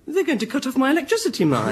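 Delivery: ordinary talk, nothing marked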